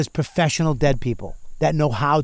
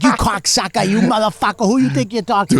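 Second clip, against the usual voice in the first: louder, higher mock Boston thug yell; tough kids yelling; 7 semitones higher, 5 dB louder than usual. Southie kids beating the actor, louder mock yelling. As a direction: bark it like a street punk. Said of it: "They're like".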